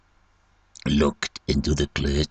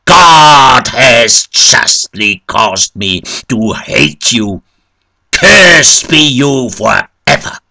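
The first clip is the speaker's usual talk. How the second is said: loud, high curse; forceful, raised denunciation; crying out; 5 semitones higher, 9 dB louder, slower than usual. A narrator's reading. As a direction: cry out in horrified condemnation. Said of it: "He trembled and cried out".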